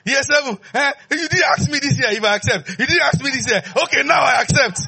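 high loud protest, then a shouted yes; hammed-up outrage turning to acceptance; indignant eager king.

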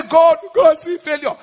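high mock prayer, loud at first; mock desperate appeal. Preacher mocking those who beg God about failure, high appeal. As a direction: plead upward as the wrong example.

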